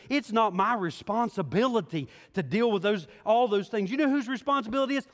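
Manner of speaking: high, loud preacher's build, driving hard; rising sermon fervor, loud and high; full-force preaching. Acted. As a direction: preach it to the whole room, pressing.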